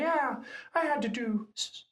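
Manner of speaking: very high, put-on voice of a cocky applicant; mock boaster. SEAL veteran mimicking overconfident applicants, high mocking voice.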